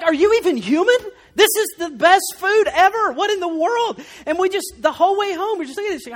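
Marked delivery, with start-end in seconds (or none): 0.0-4.0 higher, louder mock indignation; mock angry outburst. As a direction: imitate Lacey's outraged disbelief, higher and louder. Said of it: "Lacey was angry".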